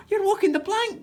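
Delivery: put-on young pirate yelling in alarm; yelled warning. A narrator's reading. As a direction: cry out to stop Granny. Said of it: "yelled Jack".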